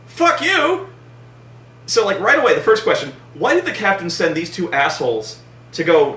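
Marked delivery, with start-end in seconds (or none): 0.0-0.9 high, sneering fuck-off shout; hammed-up rude retort; mocking yell. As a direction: fling it back at him mockingly. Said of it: "And they go".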